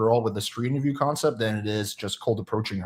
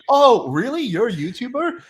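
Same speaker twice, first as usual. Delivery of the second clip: imitating a stranger, higher and louder, amazed; mock surprise; 13 semitones higher, 5 dB louder than usual. Stranger hearing he's a YouTuber, mock amazed. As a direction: play the baffled stranger who can't believe it.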